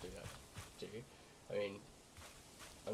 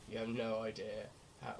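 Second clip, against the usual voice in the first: ordinary talk, nothing marked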